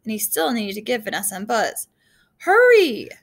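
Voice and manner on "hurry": loud, high urgent yell. A narrator's reading. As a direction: yell at them to move fast. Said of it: "Dex yelled again".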